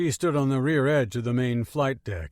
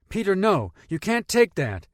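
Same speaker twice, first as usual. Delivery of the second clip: urgent protest; 8 semitones higher, 5 dB louder than usual. Acted. Girl stops boy taking gun.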